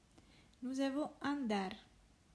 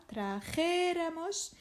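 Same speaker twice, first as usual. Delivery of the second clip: trajéramos with a high, long JÉ; stress on third-last syllable; 5 semitones higher, slower than usual. Shown as a demonstration. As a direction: stretch and lift the JÉ in trajéramos. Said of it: "Avant-avant-dernière syllabe".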